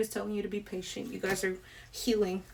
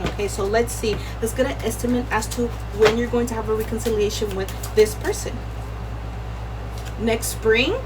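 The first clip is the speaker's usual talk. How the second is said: raised voice, talking over the AC; louder, carrying voice over background noise; speaking over noise; 6 dB louder than usual. Real, not acted. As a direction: project over a running air conditioner. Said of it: "I'm gonna try to speak over it".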